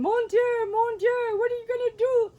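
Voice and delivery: very high, loud cry of alarm; a frantic old French grandmother wailing; panicked French grandmother. Retold or imitated. Grandmother panicking during fight.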